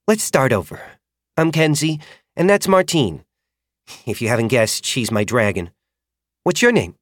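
put-on a young rider making a fresh start; friendly rider. A narrator's reading. Rider Kenzie introducing herself to the boy, friendly.

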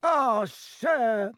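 high elf voice, gasping with emotion; gasped. Acted. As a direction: gasp it out, high and overcome. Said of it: "he gasped".

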